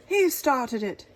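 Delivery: loud, slow accusing cry; cried. Acted. King accusing the dragon, loud cry. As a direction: blame him loudly. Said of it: "he cried".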